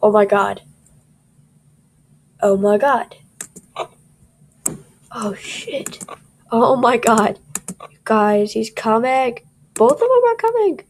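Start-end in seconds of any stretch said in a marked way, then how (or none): none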